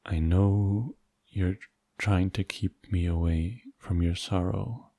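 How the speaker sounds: normal talking voice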